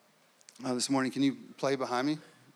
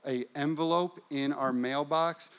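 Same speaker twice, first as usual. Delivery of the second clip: usual speaking voice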